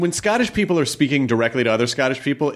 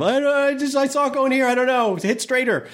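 very high-pitched exasperated whine; hammed-up fussing, pitched way up; mock complaining golfer. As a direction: imitate a flustered golfer griping at the caddie. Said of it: "getting yelled at by rich people".

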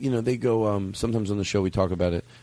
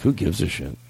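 ordinary talk

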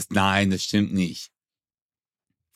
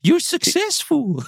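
put-on much higher, slow, swooning Oxford English accent; 13 semitones higher, slower than usual. Imitating an Oxford-English-speaking partner, in a high, swooning Oxford English accent. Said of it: "Oxford Englisch".

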